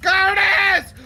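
being chased; high frantic screams for help; panicked screaming. Real, not acted. Chased by green creature, screaming.

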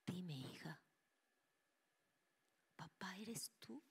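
timid whisper to a ghost; mother whispering to her dead father; hushed, hopeful whisper. Retold or imitated. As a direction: whisper the question, slow at the end. Mother asks the spirit.

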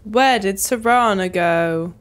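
ordinary talk

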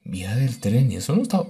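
ordinary talk, nothing marked

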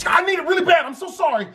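high, hurried mock pleading; frantic apology. Brother grabbing a stranger's phone, acted frantic apology.

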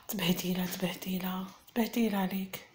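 hushed, scandalised scolding; mock-shocked hush. Gasp then shaming her. (شهقة ثم تعيير خافت ومنخفض)

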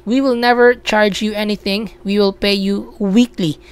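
ordinary talk

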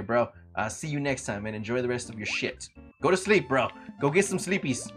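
ordinary talk, nothing marked

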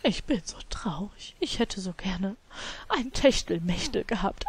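mock-sad whisper for the sim; whispered mock sadness. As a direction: play the sim's sorrow under breath.

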